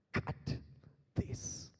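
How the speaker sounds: "Cut this" unvoiced, soft and percussive; whispered, separated "Cut this"; voiceless beatbox sounds. Shown as a demonstration. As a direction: turn "Cut this" into two drum sounds. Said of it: "You're just making the sounds".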